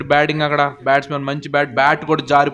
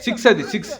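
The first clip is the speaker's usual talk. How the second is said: high, laughing shout of six; excited commentator; 7 semitones higher than usual. Real, not acted. A six is hit. (ఉత్సాహంగా నవ్వుతూ అరుపు)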